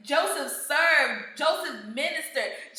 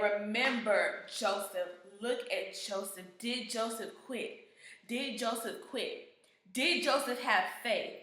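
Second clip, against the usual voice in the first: gentle voice of God; 5 semitones lower, 8 dB quieter than usual. Retold or imitated. God speaks gently to preacher.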